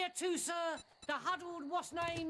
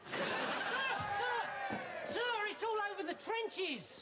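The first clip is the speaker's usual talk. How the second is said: excited; 7 semitones higher than usual. Acted. Baldrick rushes in with news.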